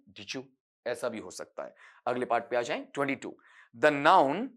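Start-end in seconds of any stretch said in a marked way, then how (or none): 0.1-0.4 "डिजू" softly, d and y merged into a j sound; blended diju. Shown as a demonstration. Teacher repeating the connected-speech sound. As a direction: repeat "डिजू" quietly as one word. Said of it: "साथ में लेकर बोलें".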